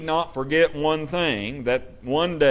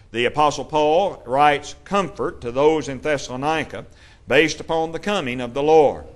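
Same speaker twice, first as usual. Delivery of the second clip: normal talking voice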